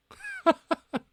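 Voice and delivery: sudden high-pitched cackle, loud; shrill gleeful laugh bursting out; high villain cackle. Acted.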